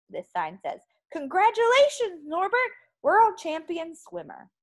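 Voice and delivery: lively, expressive picture-book narration; story reading for children. A narrator's reading. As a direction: read it to a class of little kids. Reads picture book to pupils.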